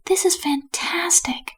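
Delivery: high, thrilled character voice; excited tourist's high-pitched delight; delighted exclamation. A narrator's reading. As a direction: marvel at it, pitched well up. Two Flower marvels at scene.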